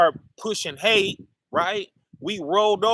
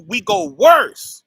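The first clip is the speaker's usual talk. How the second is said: louder, slow and higher, hammering the word worse; emphatic declaration; 7 semitones higher, 10 dB louder, slower than usual. Real, not acted. Preacher telling the congregation how we fell, loud and slow. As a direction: drop it like a verdict on worse.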